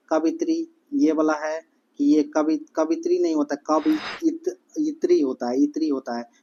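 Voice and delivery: normal talking voice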